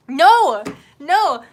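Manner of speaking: loud, high-pitched cry of NO; yelled, dragged-down refusal; emphatic protest. Real, not acted. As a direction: belt out an exasperated no. Protesting, calling out loudly.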